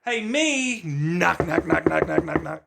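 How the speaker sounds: high, loud rapping knock knock; mock knocking call. Waking up his own mind, high and loud knocking. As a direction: knock on your own skull to wake yourself.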